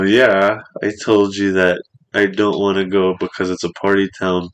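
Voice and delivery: normal talking voice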